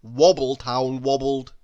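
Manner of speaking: ordinary delivery, nothing marked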